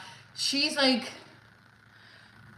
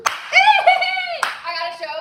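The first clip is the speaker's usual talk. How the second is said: very high, loud and slow with a laugh; excited shout; 7 dB louder, much slower than usual. Real, not acted. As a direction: shout the news in pure joy. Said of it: "Sorry, I'm excited".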